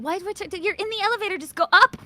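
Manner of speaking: loud, impatient, rising; exasperated yell. Real, not acted. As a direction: tell them to just go up. Yelling at game character.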